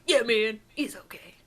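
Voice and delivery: lightly imitated street-cool voice. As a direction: put on a casual cool-guy act.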